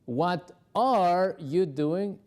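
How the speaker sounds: "are" stretched and leaned on; slow contrast drill on "are"; stress on are. Shown as a demonstration. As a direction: hit "are" slowly. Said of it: "Es, "¿qué estás haciendo?".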